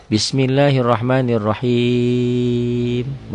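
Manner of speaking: long drawn final vowel; slow Quran recitation. Shown as a demonstration. Teacher demonstrates the long mad, drawn-out chant. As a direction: chant it out slowly. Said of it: "Mad alif disukun".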